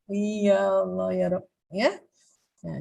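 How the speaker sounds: ordinary talk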